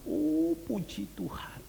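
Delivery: high, drawn-out exclamation of praise; slow, relieved "oh" up high; delighted praise. Retold or imitated. Host receiving the pastor's family, delighted praise.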